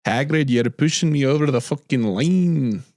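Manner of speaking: a rough mock Scottish accent, half-joking; a loose, jokey Scottish accent. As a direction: quote Hagrid in a put-on Scottish burr. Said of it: "That's more Scottish".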